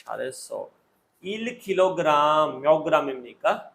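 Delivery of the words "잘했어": ordinary talk, nothing marked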